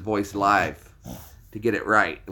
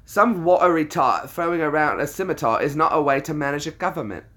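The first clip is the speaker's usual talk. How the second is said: put-on loud, higher British accent; indignant British peasant, pitched up; British film quote; 5 semitones higher, 5 dB louder than usual. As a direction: protest it loudly in a British accent. Reciting Monty Python's peasant scene.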